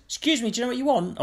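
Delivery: high, fast officious clipboard woman. Retold or imitated. Clipboard woman stopping him at the door, officious and quick. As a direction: fire it off like a jobsworth. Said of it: "acting all officious".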